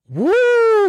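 high loud joyful woo; excited whoop. Real, not acted. Host whooping to listeners about her laptop, excited. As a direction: whoop like you're living wild.

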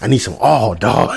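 low begging, breaking into laughter; crack fiend. Acted. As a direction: beg low like an addict, then crack up. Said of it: "He's like".

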